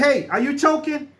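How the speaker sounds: high, urgent call to a stranger; startled shout of concern; calling out alarmed. Retold or imitated. Calling out to a choking man.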